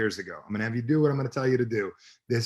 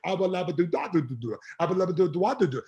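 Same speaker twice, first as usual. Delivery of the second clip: higher-pitched reversed-tape gibberish; imitating backwards speech; 5 semitones higher than usual. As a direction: babble it like a recording played backwards. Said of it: "I played, 'Why do you do that?' backwards".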